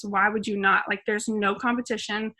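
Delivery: ordinary delivery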